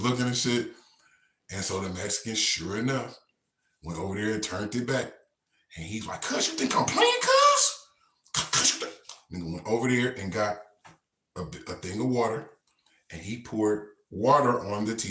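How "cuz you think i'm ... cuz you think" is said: very high, strained voice; squeaky threat. Retold or imitated. Inmate warning the Mexican before dousing TV, very high threat. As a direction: screech the threat in a high pitch.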